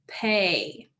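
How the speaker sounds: ordinary talk, nothing marked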